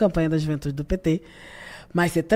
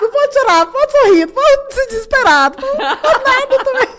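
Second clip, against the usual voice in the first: very high, loud, teary squeal; hammed-up sobbing panic; mock panicked crying; 7 dB louder than usual. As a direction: wail it like fighting back tears. Continues teary mock lament.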